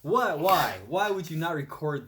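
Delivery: exasperated protest; indignant loud. Real, not acted. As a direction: demand it of the friends who didn't film. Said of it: "indignant friends didn't record".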